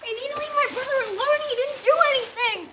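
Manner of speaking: high, loud, heated defence; upset protest. Acted. Defending an accused brother to the group, upset protest. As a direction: cry out for your brother.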